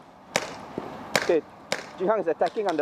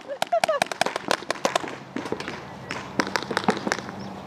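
high giggle; 4 dB quieter than usual. Real, not acted. Reacts after being shot out.

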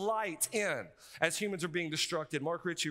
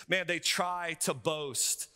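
slow emphatic; much slower than usual. Acted. Preacher slows for emphasis.

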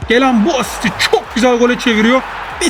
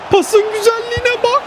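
much higher, rising with delight on bak; excited; 10 semitones higher than usual. Real, not acted. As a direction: gush over the pass, voice leaping up. Admiring the pass.

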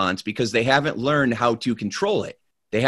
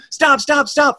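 very high, loud panicked yells; frantic yelling; 3 dB louder than usual. Acted. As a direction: scream for the horse to stop. Horse still not slowing.

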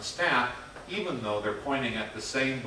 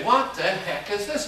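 higher-pitched baffled pianist; mock bafflement; 7 semitones higher than usual. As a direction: act out a musician thrown by the score.

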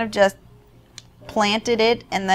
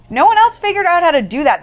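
high, loud, rushed mock amazement; incredulous mock outburst; 9 semitones higher, 8 dB louder, faster than usual. Player mocking the game's battle tactics, incredulous outburst. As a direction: blurt it out high and fast.

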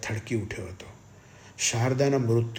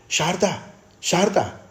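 high, loud cry of her name; shouting; 9 semitones higher, 8 dB louder, slower than usual. A narrator's reading. Brother tries to rouse his unconscious sister, shouting. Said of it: "તેણે બૂમ પાડીને કહ્યું".

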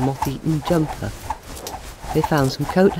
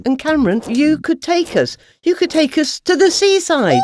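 higher, louder and pleading; eager moth; 12 semitones higher, 5 dB louder than usual. Acted. Malcolm eagerly urges Cameron, higher and louder. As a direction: coax Cameron into the trip.

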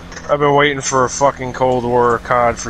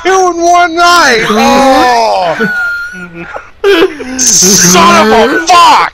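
yelling in pain after falling; hurt shouting, high and loud; tripping and falling; 11 semitones higher, 4 dB louder than usual. Real, not acted. Fell, hurting his back.

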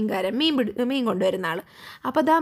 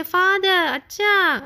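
drawn-out, raised call for father; distressed call; 5 semitones higher, 4 dB louder, much slower than usual. A narrator's reading. Upset Sachin calls his father for help, long distressed call. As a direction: call out slowly, upset, pitched up. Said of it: "എന്നിങ്ങനെ വിഷമത്തോടെ അവൻ വിളിച്ചു".

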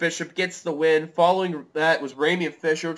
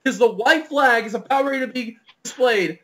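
raised, urgent race commentary; live play-by-play; 9 semitones higher, 12 dB louder than usual. Real, not acted.

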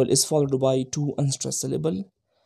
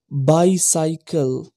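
bicycle said slowly, BI stressed and the last two syllables light; dactyl demo; much slower than usual. Shown as a demonstration. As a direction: spell out bicycle slowly, leaning hard on BI. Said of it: "The bi and si are stressed".

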